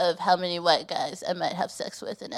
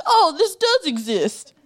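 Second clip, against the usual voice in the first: retold surprise; 7 semitones higher than usual. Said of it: "And I was like".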